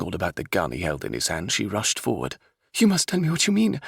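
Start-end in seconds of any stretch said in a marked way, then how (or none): 2.8-3.8 high, loud urgent demand. Acted. Princess demands the captain explain, urgently. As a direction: press him hard for an answer. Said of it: "she demanded".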